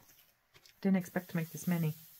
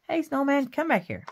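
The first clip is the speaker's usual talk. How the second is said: higher, louder mock call to the snowman; playful scolding; 7 semitones higher, 5 dB louder than usual. Crafter calling after a snowman cutout, mock scolding. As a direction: scold the sliding paper snowman.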